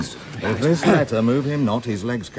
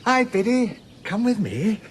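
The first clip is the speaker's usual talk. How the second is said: high sing-song coaxing; mock tender baby voice; coaxing baby talk; 11 semitones higher, slower than usual. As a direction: coo at him like a pet. Coaxing Malvolio like a child.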